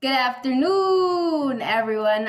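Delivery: drawn-out loud welcome; loud greeting. Real, not acted. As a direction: greet the room slowly and loudly. Opening greeting to audience.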